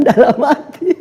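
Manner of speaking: slow high laughing correction. Real, not acted. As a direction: laugh at your own slip. Laughing at her own slip. (tertawa sambil meralat)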